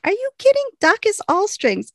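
panicked disbelief. Retold or imitated. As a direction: play the frantic sister, pitch shooting up. Imitating panicked Waverly.

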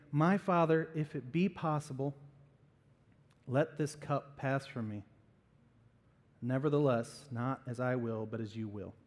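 soft, low-pitched pleading that fades; subdued prayer. Acted. Jesus praying to his Father in the garden, subdued. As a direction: sink gradually into a hushed, submissive plea.

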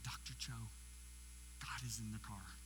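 low, hushed awe; reverent. Retold or imitated. Driver turning to Doctor Cho in the car, hushed reverence. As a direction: say it softly and reverently. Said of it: "I just very reverently turned and said".

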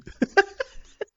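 shrill burst of giggling; high squeal of laughter. Real, not acted. Host squeals with laughter at friend's mistake.

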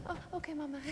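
put-on high, soft child's voice; small voice giving in after crying; little boy. Little boy calming, answering his mother.

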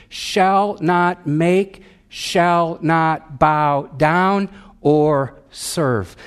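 "Shall not make, shall not bow down or serve" drawn out very slowly, each step weighted; slow emphatic quote. Shown as a demonstration. Pastor stressing each step of idol worship to the church, slow and deliberate. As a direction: spell out each step slowly so the progression lands. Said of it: "notice how progressive idol worship is".

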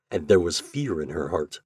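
usual speaking voice

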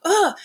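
mock groan. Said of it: "I was like".

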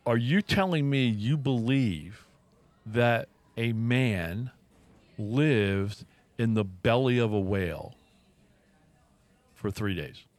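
slow, measured challenge, phrase by phrase; slow incredulity. Retold or imitated. Stepson challenging stepdad over Jonah and the whale, slowly incredulous. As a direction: spell out the absurdity with long pauses.

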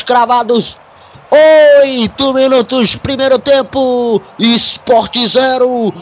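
screaming goal call, stretched and high; explosive goal commentary. Real, not acted. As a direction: roar the goal, drawing out every word.

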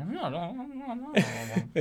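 high-pitched wordless babble; high mock-European babble; Jokic. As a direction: imitate high, rapid no-no noises. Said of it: "I feel like more high pitched".

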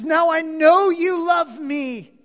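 God declaring relief and love to Abraham; loud joyful declaration. Acted. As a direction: proclaim it with emotion. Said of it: "and God says".

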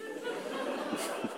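a quick breathy laugh at his own joke; short airy chuckle. Real, not acted. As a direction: let a light airy laugh slip out.

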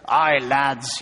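put-on low, lightly done Scottish accent; attempted Scottish accent. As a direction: have a modest go at a Scottish accent. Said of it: "it's my best Scottish accent".

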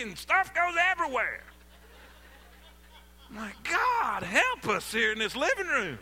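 loud, high exasperated rant; venting anger. Retold or imitated. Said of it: "I'm just venting a little anger right there".

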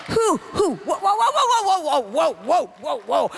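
high rapid whoas, dropping back down; mock excited whoops. Acting David whirling his sling.

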